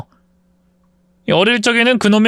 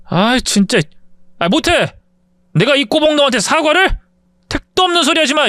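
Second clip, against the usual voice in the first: higher, scornful refusal; defiant bully; 10 semitones higher than usual. Acted. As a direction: refuse with contempt.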